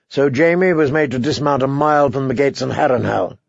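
plain reading voice

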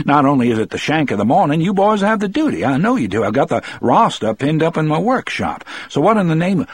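high, rising indignant protest; agitated bewilderment. A narrator's reading. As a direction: pile up baffled objections, pitch climbing. Bewildered warden scolds his men.

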